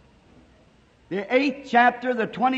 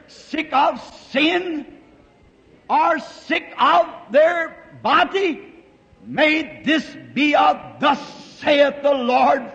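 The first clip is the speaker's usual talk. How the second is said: slow, rising preacher's shout; preaching at full force; 5 semitones higher, 4 dB louder, slower than usual. Real, not acted. Preacher calls down healing for the sick, slow fervent shout. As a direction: cry it out like a revival preacher.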